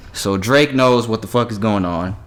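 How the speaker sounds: ordinary delivery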